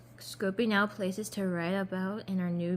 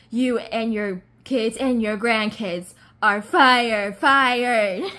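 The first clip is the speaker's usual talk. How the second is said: loud, drawn-out, over-the-top firing; dramatic movie firing; 3 semitones higher, 9 dB louder, much slower than usual. Retold or imitated. Imitating the movie boss firing everyone, melodramatic shout. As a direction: build to a big hammed-up shout. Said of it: "so much more dramatic in the movie".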